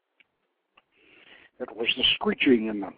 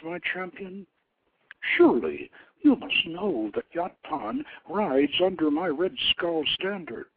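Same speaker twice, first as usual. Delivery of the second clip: higher, proud and stern goddess of war; lofty goddess voice, higher and stern; 5 semitones higher, 3 dB louder than usual. A narrator's reading. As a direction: scold the king from above like a goddess. Goddess Anath rebukes the king.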